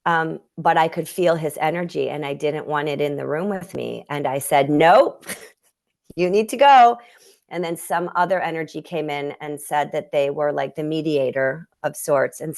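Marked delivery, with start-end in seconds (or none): 4.7-7.0 sharp, loud dismissal breaking into a laugh; firm loud nope. Retold or imitated. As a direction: shoo the unwanted energy out, loud and laughing. Sending grandfather's energy away.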